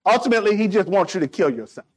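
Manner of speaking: ordinary talk, nothing marked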